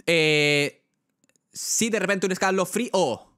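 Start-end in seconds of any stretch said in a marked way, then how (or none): 1.5-3.3 escalofrío con la O final golpeada y alta; O final marcada. Shown as a demonstration. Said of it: "aunque tú marques esa O". (acento marcado en la o final de escalofrío)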